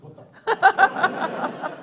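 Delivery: surprised. Retold or imitated. Finding the yarn fish on his car seat, shocked and laughing. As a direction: yelp of amused disbelief. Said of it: "I was like".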